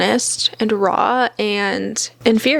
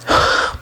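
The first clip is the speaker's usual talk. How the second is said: sharp unvoiced gasp of shock; quick audible gasp. Real, not acted. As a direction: gasp as if scandalised. Reacting to a harsh review.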